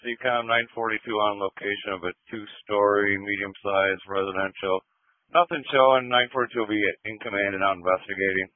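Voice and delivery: crackly two-way radio; police radio. Real, not acted. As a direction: voice through a handheld radio. Fire unit radio transmission.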